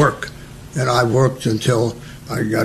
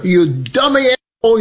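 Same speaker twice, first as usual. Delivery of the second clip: mock sergeant scolding; 7 semitones higher, faster than usual. Sergeant insults recruit.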